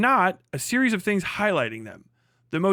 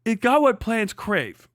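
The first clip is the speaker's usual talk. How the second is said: slow, higher dopey movie voice; Idiocracy quote; 4 semitones higher, slower than usual. Retold or imitated. Quoting Idiocracy at the co-host in a slow, higher dopey voice. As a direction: drawl it like a dim character. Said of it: "you sound like the Idiocracy people".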